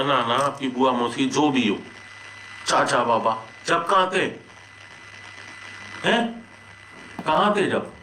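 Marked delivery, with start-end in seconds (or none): 3.7-7.9 high-pitched, accusing questions; indignant challenge. Real, not acted. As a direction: demand an answer from those who stayed away. Challenging absent relatives in a quarrel. (ऊँची आवाज़ में नाराज़गी भरे सवाल)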